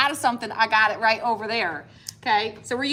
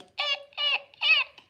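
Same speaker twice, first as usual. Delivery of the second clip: mock timid whimper; much slower than usual. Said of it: "We'd be like".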